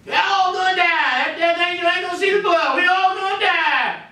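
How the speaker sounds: high, wailing mock panic; panicked doomsayer. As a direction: cry out as if doom is certain. Acts the panicking type once more.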